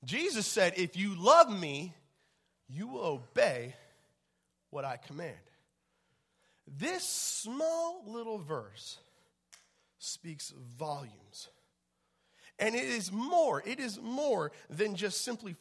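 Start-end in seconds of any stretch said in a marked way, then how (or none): 0.8-5.4 "If you love me" slow and loud, "love" rising, then "you will obey" held firm; said again with weight. Shown as a demonstration. Repeating Jesus' words to the congregation, slow and loud. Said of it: "let me just say that again". 6.7-11.5 slow hushed emphasis. Acted. Hushed reflective preaching line.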